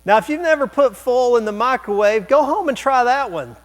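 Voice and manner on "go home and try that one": high, loud mock encouragement; joking dare. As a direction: egg them on in jest. Joking dare, then taken back.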